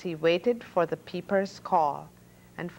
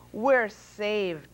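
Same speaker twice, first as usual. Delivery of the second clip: usual speaking voice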